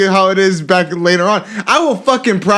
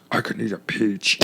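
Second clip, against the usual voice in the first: low, soft murmured impression; amateur low actor voice; Nick Cage; 10 semitones lower, 9 dB quieter than usual. Learner practising a Cage line, low and soft. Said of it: "I'm gonna s-, continue doing".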